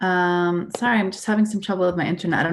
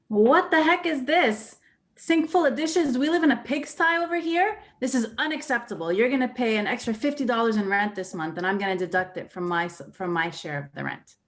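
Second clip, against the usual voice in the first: acted aggressive telling-off; 2 semitones higher than usual. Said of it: "not only aggressive, but creative".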